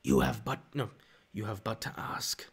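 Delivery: a lightly voiced dark lord offering power. Doing Star Wars villain lines.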